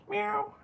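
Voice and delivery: squeaky meow; high cat meow. Pretend. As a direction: mew like a cat.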